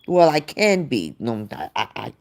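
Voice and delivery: ordinary talk, nothing marked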